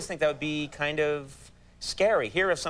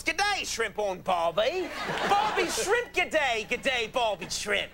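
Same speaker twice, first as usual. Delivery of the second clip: slow, sing-song fake Australian, wild high jumps; hammed-up Australian accent; 7 semitones higher, much slower than usual. Host playing an Australian guy in a slow cartoon Aussie accent. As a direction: mangle the Aussie phrases like a bad actor. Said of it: "here comes Mister Phelps".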